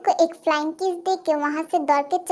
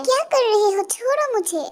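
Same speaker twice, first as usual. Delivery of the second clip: high-pitched struggling protest; alarmed protest; 6 semitones higher than usual. Acted. Girl grabbed by two men, acted alarm. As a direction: protest in a higher voice while grabbed.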